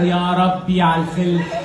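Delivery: slow, stretched call to Siri; slow drawn-out call. Retold or imitated. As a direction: drag it out like a weary call. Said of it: "بنده عليها انا".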